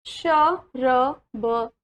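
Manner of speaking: शरबत split into very slow syllables. Shown as a demonstration. Teacher sounding out शरबत slowly for the children. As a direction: draw out श-र-ब-त slowly. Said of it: "Again पढ़ेंगे ma'am के साथ".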